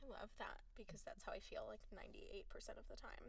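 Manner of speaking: breathy near-whisper. Real, not acted. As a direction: drop to a whisper. Soft aside, agreeing.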